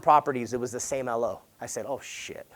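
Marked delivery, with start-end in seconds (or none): 1.9-2.5 under-the-breath oh shit; muttered curse. Retold or imitated. Realizing the same loan officer is on every listing, muttered under the breath. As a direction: mutter it to yourself in shock.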